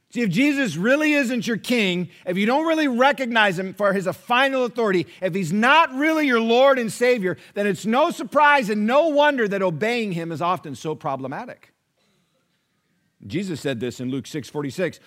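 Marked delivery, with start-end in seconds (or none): 0.1-11.7 louder, driving sermon voice; fiery preaching. Acted. Pastor pressing the congregation on who is really their king, preaching hard. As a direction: build it like a pulpit climax.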